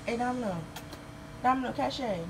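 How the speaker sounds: high, loud call across the yard; calling the dogs. Real, not acted. Owner calling her dogs across the yard, high and loud. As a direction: sing out their names to get their attention.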